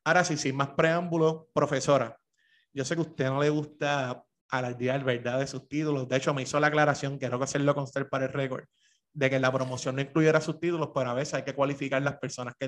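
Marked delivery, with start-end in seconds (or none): none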